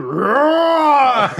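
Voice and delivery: loud, long drawn-out roar; imitated roar. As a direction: let out a big mock roar. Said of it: "Fucking some roar came".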